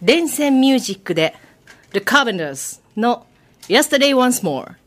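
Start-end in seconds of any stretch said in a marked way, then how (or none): 1.9-4.7 "[name]" and "[name]" said as native English inside Japanese; English pronunciation. Real, not acted. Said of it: "発音はいいんだよな". (「[name]」「[name]」を本場の英語発音で)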